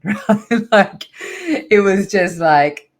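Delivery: retelling a joyful moment, still laughing. Real, not acted. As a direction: laugh out the words in delight. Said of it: "I just started laughing".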